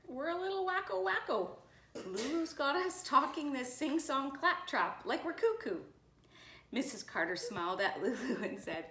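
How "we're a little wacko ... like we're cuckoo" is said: high-pitched, fretful complaint; flustered principal. A narrator's reading. Mister Thomas tells the nurse they're wacko, flustered. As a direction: report it in a high, rattled voice.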